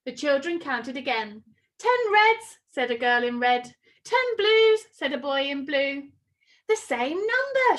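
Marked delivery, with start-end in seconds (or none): 1.8-2.6 calling out. A narrator's reading. Children calling out team counts. 4.0-4.9 calling out. A narrator's reading. Children calling out team counts. 6.7-7.8 higher, eager call; excited shout. A narrator's reading. Spinderella shouting with excitement in the classroom, higher and eager. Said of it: "shouted Spinderella".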